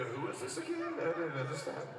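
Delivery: confused Brits. Pretend. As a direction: play baffled locals hearing him. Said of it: "they would go".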